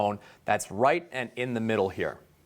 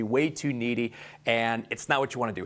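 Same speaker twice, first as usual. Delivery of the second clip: usual speaking voice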